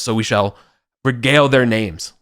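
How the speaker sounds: normal talking voice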